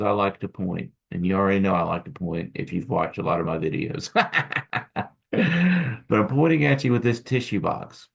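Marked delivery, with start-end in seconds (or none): 4.2-5.1 laugh. Real, not acted. Laughs at own pointing habit.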